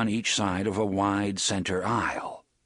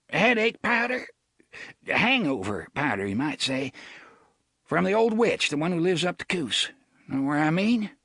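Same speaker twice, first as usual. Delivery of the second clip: blustering sheriff voice; 7 semitones higher than usual. Acted. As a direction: play the fussy rural sheriff. Avery voiced.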